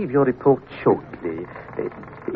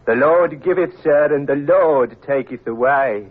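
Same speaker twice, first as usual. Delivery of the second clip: solemn scripture recital; 3 semitones higher than usual. Acted.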